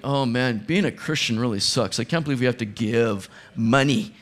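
mock grumbling. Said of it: "with grumbling".

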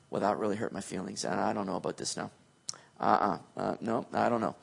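hammed-up hurt feelings, mumbled low; mock sulking God. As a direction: play a pouty, offended God for laughs.

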